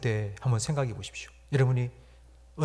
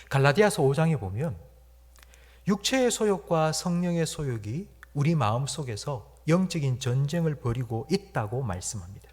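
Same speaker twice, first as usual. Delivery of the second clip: ordinary talk, nothing marked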